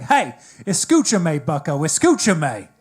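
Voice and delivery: put-on loud, drawn-out Spanish in a Southern accent; hammed-up Southern teacher attempting Spanish; bad Southern-accented Spanish. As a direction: mangle the Spanish like a Southern schoolteacher. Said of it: "he couldn't speak Spanish".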